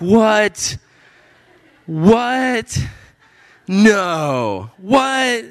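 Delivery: high-pitched incredulous outbursts; baffled exclamations, each left hanging; stunned disbelief. Retold or imitated. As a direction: recoil in hammed-up astonishment. Retelling his stunned reaction to coincidence.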